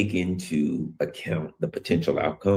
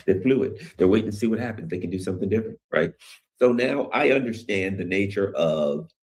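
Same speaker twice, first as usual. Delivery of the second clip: ordinary talk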